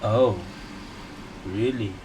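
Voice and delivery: usual speaking voice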